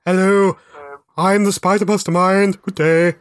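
a mock-grand posh English greeting; posh English accent. Voicing the villain to his co-hosts, hammed-up posh English accent. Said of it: "he'd still have a posh accent".

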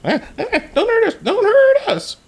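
very high, fast squeaky goblin pleading; panicked goblin. Acted. Captured goblin begging the hero not to hurt him, high panicked squeak. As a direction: beg for mercy in a tiny high voice.

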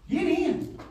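loud, high urging; rising urgent altar call; fervent altar call. Acted. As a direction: push them to come forward.

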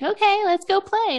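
high-pitched kid imitation; eager young son. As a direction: pipe up like a child ready to play. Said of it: "he's like".

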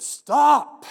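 sudden booming shout of stop; full-voiced commanding yell; loud yell. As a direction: bellow it to halt the knife. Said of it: "I yelled real loud".

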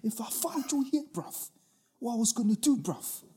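high, hammed-up British geezer voice; squeaky mock London hard man, bruv; bad British accent. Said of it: "Can't do a British accent".

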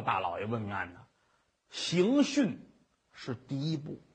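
usual speaking voice

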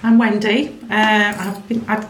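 ordinary delivery

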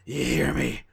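low throat-straining growl; drawn-out gruff roar; Vince McMahon. Acted. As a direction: growl it out like a wrestling boss, sinking low. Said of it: "It hurts so much".

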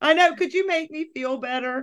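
high, hammed-up childish whine to husband; whiny little girl. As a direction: play the hurt kid begging to be comforted. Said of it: "Susie was mean to me".

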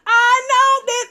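high, slow, stunned; stunned disbelief. Retold or imitated.

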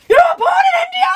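very high, loud shriek of disbelief; hammed-up shouted question; astonished exclamation. A narrator's reading. Indian gentleman learns the girl was born in India, astonished exclamation. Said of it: "he exclaimed".

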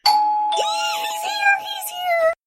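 very high-pitched squeal of excitement; excited shriek. Acted. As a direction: shriek it sky-high, thrilled. Girl shrieks as dog arrives.

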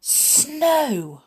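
high delighted exclamation; excited cry. Acted. Mister Silly seeing snow from his window, excited cry. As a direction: shout it out in glee. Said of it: "when he looked out of his bedroom window".

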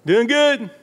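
high, loud, chirpy reply; breezy pat answer. Acted. Someone answers that on the outside they're okay, breezy. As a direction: put on an everything's-okay front.

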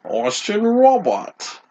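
slow, high mock British accent. Co-host introducing himself in a hammed-up British accent. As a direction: introduce yourself like a pompous British stage actor. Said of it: "Famed British actor".

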